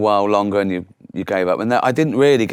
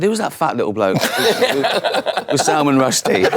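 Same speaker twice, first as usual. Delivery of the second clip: laughing mock quote; 5 semitones higher than usual. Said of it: "He must have said".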